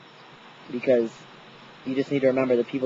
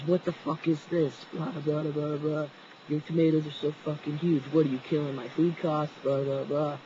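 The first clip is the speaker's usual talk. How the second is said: retold angry rant; 3 semitones higher, 3 dB quieter than usual. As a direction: imitate a ranting boss without really yelling. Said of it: "why you yelling".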